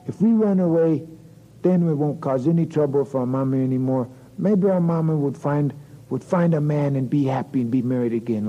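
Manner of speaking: put-on high, eager child voice; plotting little boy. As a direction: urge sister to run away. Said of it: "Little boy goes".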